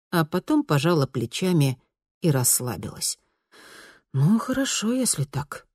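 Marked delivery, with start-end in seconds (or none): 4.1-5.7 grumbling. A narrator's reading. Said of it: "ворчливо произнесла она".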